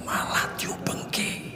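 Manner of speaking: breathy voiceless aside, slow; whispered aside. Acted. Preacher confiding a teasing remark to the congregation, whispered. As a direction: drop to a whisper as if sharing a secret. Aside mid-story to audience.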